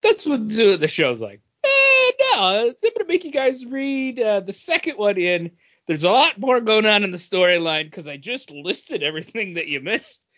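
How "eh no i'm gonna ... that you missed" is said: high, whiny mocking voice of the show. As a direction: sneer it out like a smug narrator. Mocking the show explaining plot.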